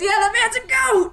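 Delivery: very high, cracking-up shout; uproarious laughing. Acted. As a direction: shout it through helpless laughter. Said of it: "the man just laughs and goes".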